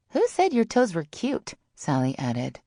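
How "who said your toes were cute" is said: high-pitched friend voice; high, teasing jab; Sally's voice. A narrator's reading. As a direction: toss in a high teasing question.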